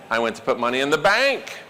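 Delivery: emphasis demo with bank standing out; stress on bank. Shown as a demonstration. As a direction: run through low, then hit the word bank. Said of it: "punch words for emphasis".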